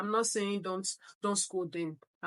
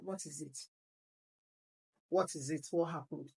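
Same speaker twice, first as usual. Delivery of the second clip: soft coaxing; 6 semitones lower, 6 dB quieter than usual. Retold or imitated. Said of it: "She called him close".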